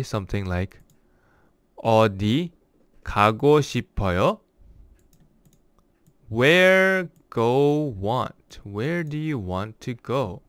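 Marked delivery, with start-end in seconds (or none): 6.3-8.6 slow word-by-word gloss. Shown as a demonstration. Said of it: "teacher glosses word by word".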